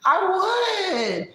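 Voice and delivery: high, drawn-out insistence; high, falling gushy caricature; Vegas. Acted. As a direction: stretch it out in a high put-on voice. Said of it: "She sounds like a character".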